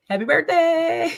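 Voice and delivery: high, loud happy-birthday call; birthday shout. Acted. Fan calling happy birthday to the singer, high and loud. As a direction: belt it out like a fan.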